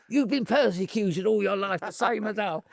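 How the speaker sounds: high-pitched imitation of a persuasive promoter; rough impression, high and insistent; Don King. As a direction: mimic the promoter working on his listener, pitched right up. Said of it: "I can't do his accent".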